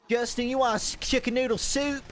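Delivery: high-pitched Southern granny offering soup; Southern grandma. Acted. Grandma asking grandson about soup, high Southern voice. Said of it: "she'd ask if I want".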